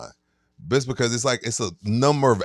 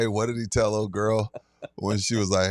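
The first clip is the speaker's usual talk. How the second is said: usual speaking voice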